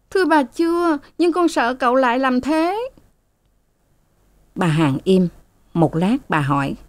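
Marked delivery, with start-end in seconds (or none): 0.1-2.9 high, anxious pleading; frightened maid. A narrator's reading. Sen pleads, afraid. (giọng cô hầu sợ sệt, cao)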